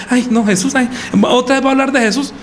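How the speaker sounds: imitating a fed-up listener; put-on "not this again" protest; mock complaint. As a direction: grumble it as someone sick of the sermon. Mimics bored, rejecting listener.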